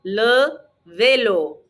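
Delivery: LE VÉLO drawn out slowly; teacher modelling LE VÉLO for repeating; LE, slight gap, VÉLO; slow model pronunciation. Shown as a demonstration. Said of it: "Répétez".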